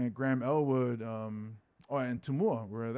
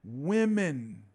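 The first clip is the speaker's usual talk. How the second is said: usual speaking voice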